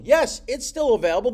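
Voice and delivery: high, loud, eager confirmation; eager homeowner's quick yes; excited seller. Acted. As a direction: jump on it like a hopeful seller. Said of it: "he's jumping up and down".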